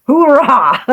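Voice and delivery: high, loud hoorah; hoorah cheer. Pretend. Rallying viewers on, loud cheer. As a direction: bark it like a rally cry.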